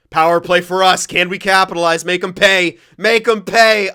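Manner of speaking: loud eager urging; rally the team like a fan yelling at the screen; urging power play. Real, not acted. Gamer cheers his team on the power play, loud and eager.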